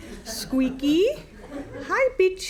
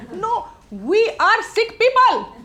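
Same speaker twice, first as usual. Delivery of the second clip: raised, drawn-out outcry; exasperated protest; 7 semitones higher, 5 dB louder, slower than usual. Acted. Pleading with a threatening caller, exasperated protest. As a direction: protest loudly, dragging the words.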